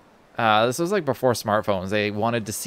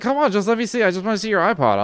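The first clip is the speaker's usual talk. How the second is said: a raised, coaxing plea, lightly mimicked; mock pleading insistence; 9 semitones higher, 3 dB louder, faster than usual. Imitating the mugger badgering to see the iPod, mock coaxing. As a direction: needle him, half-mocking.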